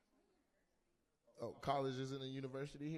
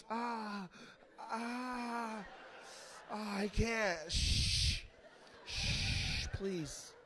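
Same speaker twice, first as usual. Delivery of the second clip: higher-pitched mock moans, then hushing pleas; mock agony, shushing and begging; overwhelmed noise-sensitive lover; 5 semitones higher, much slower than usual. Comic mimics man begging for his headphones during sex, mock distress. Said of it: "You're like".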